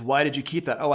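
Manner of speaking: normal talking voice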